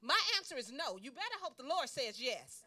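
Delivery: loud, sassy, laughing comeback; firm retort. Retold or imitated. She tells sister no, sassy and loud. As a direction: put her firmly in place. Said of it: "I go".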